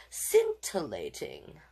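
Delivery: Scintillating said slowly, each syllable distinct; savoring the word. Shown as a demonstration. Teacher relishing a sparkly word for the kids, slow. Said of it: "Can you make your eyebrows dance".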